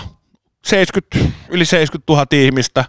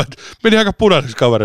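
normal talking voice

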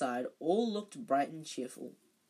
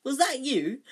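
high, loud delighted question; thrilled someone helped him; a boy thanking a ghost, high and loud; grateful surprise; 9 semitones higher, 9 dB louder than usual. A narrator's reading. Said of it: "said Harry gratefully".

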